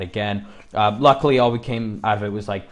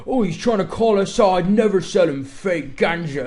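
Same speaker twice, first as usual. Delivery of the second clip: higher, louder mock-cool dealer voice; 6 semitones higher, 5 dB louder than usual. Dealers brushing off the accusation, higher put-on cool voice. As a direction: play it smug and cool, in a put-on voice. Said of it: "being all cool".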